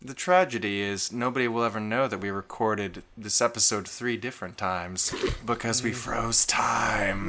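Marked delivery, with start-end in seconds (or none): 1.5-7.3 whispered. Real, not acted. Confides the secret.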